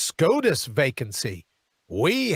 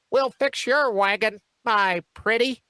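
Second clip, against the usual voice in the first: witch voice; 3 semitones higher than usual. Retold or imitated. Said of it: "I'm not calling her a witch".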